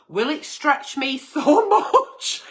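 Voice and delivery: slow words broken by laughter; can't keep a straight face; laughing while reading. Real, not acted. Agony aunt cracking up reading the letter, laughing. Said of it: "laughs while reading".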